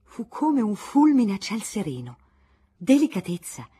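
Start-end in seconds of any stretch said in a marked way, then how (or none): 2.8-3.7 loud, indignant exclamation; exclaimed. Acted. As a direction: blurt it out in outraged surprise. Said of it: "esclamò Giulente".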